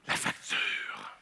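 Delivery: slow, dreadful whisper; hushed, drawn-out horror; dramatic whisper. Acted. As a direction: whisper it like an ominous reveal. Girlfriend holds the receipt, dread.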